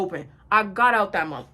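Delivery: ordinary talk, nothing marked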